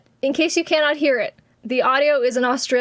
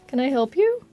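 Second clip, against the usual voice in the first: usual speaking voice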